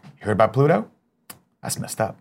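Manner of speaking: understated character impression, trailing off very softly; lightly imitated quiet dismay; Gus from Psych. Shown as a demonstration. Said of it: "your best Gus impression from Psych".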